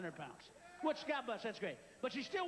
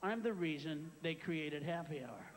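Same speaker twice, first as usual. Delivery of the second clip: gloomy husband; 9 semitones lower than usual. Retold or imitated. Husband thinking at Happy Hour, low and deadpan. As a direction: grumble it like a beaten-down drinker. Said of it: "He has that look like".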